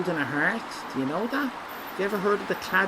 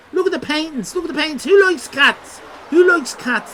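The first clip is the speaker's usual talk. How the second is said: loud, high call to the crowd; 10 semitones higher, 6 dB louder than usual. Real, not acted. Streamer calling to chat about cat paintings, loud and high. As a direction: call it out like a showman.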